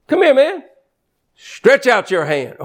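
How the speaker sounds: high, eager call to come over; a playful high call to step up. Retold or imitated. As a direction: beckon him forward with a lift. Jesus calling, replayed higher.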